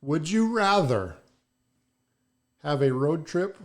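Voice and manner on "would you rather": ordinary delivery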